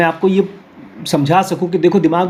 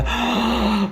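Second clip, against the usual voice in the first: a soft, higher gasp of mock dismay; 5 semitones higher, 6 dB quieter than usual. Student imagining a doctor's workload, mock gasp. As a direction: gasp at the thought of all that studying. Imagined reaction to heavy studying.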